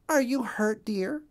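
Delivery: voiced high, a worried mother duck; worried wife. A narrator's reading. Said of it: "she asked".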